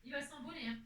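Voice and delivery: soft, high, cooing pet talk; talking to her pet. Real, not acted. Owner coos quietly to her pet, soft and high. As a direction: coo gently at the little dog.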